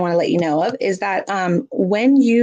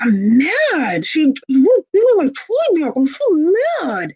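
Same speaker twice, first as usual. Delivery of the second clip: high, louder, slowed mock-angry complaint; exaggerated mad; 8 semitones higher, 3 dB louder, slower than usual. Shown as a demonstration. Parent modeling anger about a taken toy: high mock-angry whine. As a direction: pout it out like a kid whose toy was taken. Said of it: "we might go".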